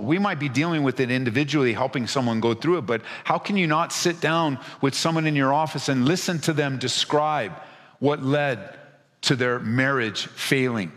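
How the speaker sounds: normal talking voice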